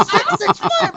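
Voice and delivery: very high, shrieking disbelief; panicked scream. Retold or imitated. Boy hearing his dad found the number, high panicked shriek. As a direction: shriek it like your world just ended. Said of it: "And I'm, like, screaming".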